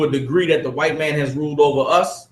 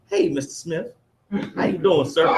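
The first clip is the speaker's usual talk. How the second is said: polite to the boss; 2 semitones higher, 4 dB quieter than usual. Retold or imitated. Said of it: "it's they boss".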